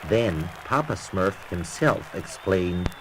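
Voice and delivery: usual speaking voice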